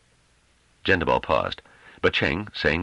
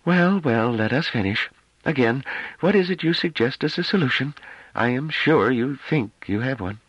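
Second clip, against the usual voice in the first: affected weariness; 11 semitones higher than usual. A narrator's reading. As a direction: sigh it out, bored and superior. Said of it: "with an affectation of weariness".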